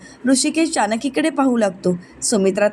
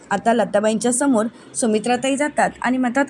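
plain reading voice

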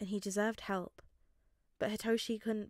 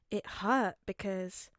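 ordinary delivery

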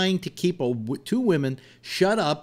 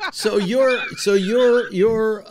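high, louder laughing start of a retort; 8 semitones higher, 6 dB louder, much slower than usual. Real, not acted. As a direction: laugh through the words while pressing the point.

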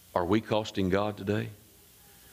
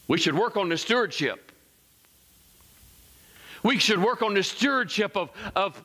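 higher-pitched, driving sermon delivery; fervent preaching; 7 semitones higher than usual. Real, not acted. Pastor urging the congregation to work on stewardship, fervent. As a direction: preach it in a raised, insistent pitch.

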